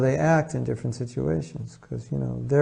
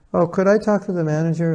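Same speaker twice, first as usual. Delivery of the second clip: higher-pitched, courteous asking; nice, polite request; 5 semitones higher than usual. Acted. Customer politely asking the cashier for the manager, nicely. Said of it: "He wasn't nice about it".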